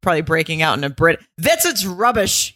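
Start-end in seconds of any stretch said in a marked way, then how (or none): 1.4-2.5 a light mock British accent, higher; imitating an English husband in a British accent; jokey British accent for the editor. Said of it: "he's saying in his British accent".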